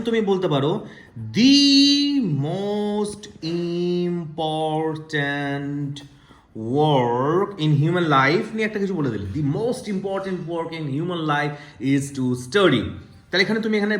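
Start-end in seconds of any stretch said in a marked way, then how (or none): 1.3-8.5 very slow, "The" stretched as a stressed "dee"; emphatic the. Shown as a demonstration. Teacher models emphatic "dee" in a grand sentence. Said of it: "জোর দিয়ে বলার চেষ্টা করো". 9.4-12.8 very slow, "The" stretched as a stressed "dee"; emphatic the. Shown as a demonstration. Teacher models emphatic "dee" in a grand sentence. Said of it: "এখানে তুমি দি বলতে পারো".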